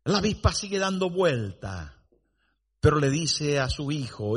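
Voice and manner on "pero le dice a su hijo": usual speaking voice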